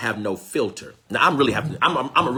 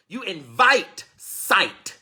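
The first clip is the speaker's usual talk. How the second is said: very slow, louder, higher stretch of invite; slow emphatic; 8 semitones higher, 3 dB louder, much slower than usual. Shown as a demonstration. Preacher repeating the point to the congregation, slow and emphatic. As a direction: drag each word out, pressing invite.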